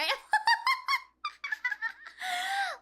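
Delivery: high-pitched squeal of laughter; helpless high laughter; shrieking laugh. Real, not acted. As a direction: crack up with a piercing squeal. Cracks up at own silly voice.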